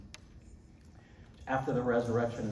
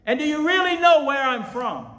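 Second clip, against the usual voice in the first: high, roaring question; loud voice; 12 dB louder than usual. Acted. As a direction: cry out each question at full force. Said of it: "I believe that's the way he said it".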